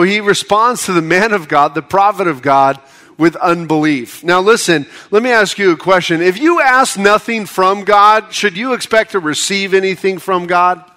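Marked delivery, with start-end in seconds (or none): none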